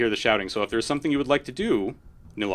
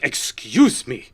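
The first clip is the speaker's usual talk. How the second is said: slow, drawn-out offended excuse me; affronted noble lady; total indignation; much slower than usual. Acted. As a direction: stretch it out, scandalized. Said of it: "Like, total indignation".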